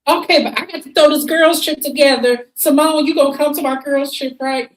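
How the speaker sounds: high, loud, sing-song invitation; Contessa voice. Retold or imitated. Mock Contessa pushing Simone to join her trip, high and loud. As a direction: pitch it up bright and pushy. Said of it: "here come Contessa".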